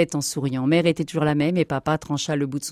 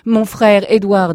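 loud, higher proud exclamation; overjoyed announcement; 5 semitones higher, 9 dB louder, slower than usual. Acted. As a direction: announce it in amazed joy. Captain announces his found brother.

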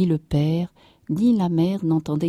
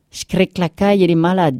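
loud, rustic man announcing trouble; distressed peasant farmer; 2 semitones higher, 7 dB louder, faster than usual. A narrator's reading. Farmer coming in about his sick cow, loud dismay. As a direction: blurt the bad news loudly.

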